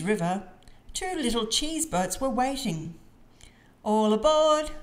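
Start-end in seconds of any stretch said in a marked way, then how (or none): 3.8-4.7 high, loud boarding call. A narrator's reading. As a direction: call it out like a conductor. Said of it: "called Mandy".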